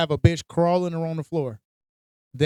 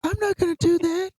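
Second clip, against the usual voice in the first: high mocking parrot of her words; mocking imitation; 11 semitones higher than usual. Mocking his partner's refusal in a higher voice.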